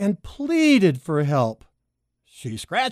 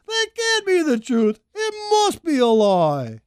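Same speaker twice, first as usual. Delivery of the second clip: crying protest; 11 semitones higher than usual. A narrator's reading. Child refuses to believe.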